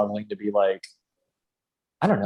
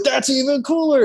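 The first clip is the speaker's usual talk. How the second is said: very high, louder delighted squeal; excited praise; 5 dB louder than usual. Retold or imitated. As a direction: squeak it out, impressed. Videographer reacting, quoted.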